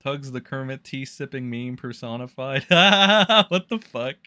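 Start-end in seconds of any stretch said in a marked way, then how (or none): 2.7-4.2 slow, loud laughing what the fuck; laughing disbelief. Real, not acted. Streamer reading a chat comment, laughing.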